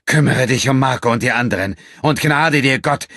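grim warning. A narrator's reading. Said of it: "mit jetzt wieder finsterer Miene".

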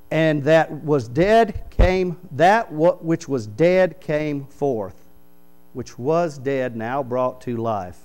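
very slow, weighted King James reading. Shown as a demonstration. Preacher reads King James to congregation, very slow. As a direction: savor each word of the old verse. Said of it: "reading King James slowly".

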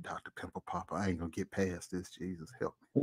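low, soft, defeated moan; mock despair. Cohost pretends to pray in defeat, low and soft. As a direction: murmur it low like a beaten man praying. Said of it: "He said".

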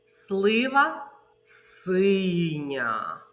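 "Слива синя" drawn out very slowly; very slow phrase. Shown as a demonstration. Teacher modelling the rain word game. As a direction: stretch every syllable. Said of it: "ознаки повільно".

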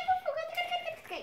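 very high, soft repeated coo; cooing at a puppy. Real, not acted. Luring the puppy closer, high soft cooing. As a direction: lure it with tiny high coos.

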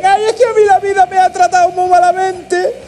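very high, mocking victim voice; mock a hard-luck case in a squeaky drawn-out voice; self-pitying whiner. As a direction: whine it high, dragging out the end. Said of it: "no de esos hijos de puta que hay por ahí que dicen".

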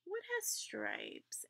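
soft, slow, high questioning hush; hushed teacher echo. Acted. Teacher hushing to hint at the answer, soft. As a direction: draw them in with a hushed question.

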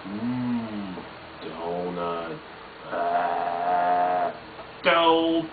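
a hint of Homer craving a donut; Homer impression. Vlogger doing Homer for viewers, lightly voiced.

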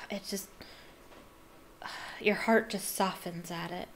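soft, sighing whisper. Real, not acted. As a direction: breathe it, moved. Sighing, moved.

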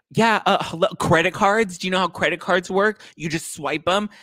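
high, loud mock exasperation; hammed-up sarcastic outrage; going off, condescending. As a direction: imitate a customer talking down to staff. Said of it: "he's going off on her".

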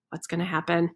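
plain reading voice

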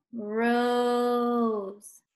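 "Rose" stretched out evenly; slow. Shown as a demonstration. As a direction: hold the long O in "Rose". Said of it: "Say it slow".

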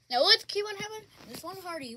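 usual speaking voice

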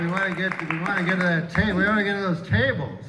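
loud, building urgency about getting to the tables; loud exclaiming. Real, not acted. Crowd-work joke, voice rising to yell.

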